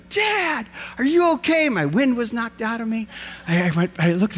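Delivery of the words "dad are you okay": put-on worried kids. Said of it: "they skied over to me".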